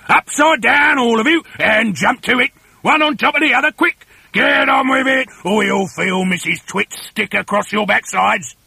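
loud, high barked orders; fearsome monkey trainer. Acted. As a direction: bark drill commands with a threat behind them. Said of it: "barked in his fearsome monkey trainer's voice".

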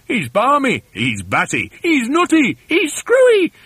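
alternating voices, climbing very high; escalating high-pitched mocking cries; crying chorus. A narrator's reading. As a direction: toss accusations round a flock of voices. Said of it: "they cried".

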